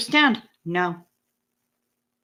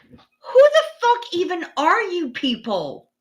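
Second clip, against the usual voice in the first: higher, incredulous exasperation; exasperated; 7 semitones higher than usual. Real, not acted. Streamer fed up with chat's pasty takes, exasperated. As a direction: throw up your hands.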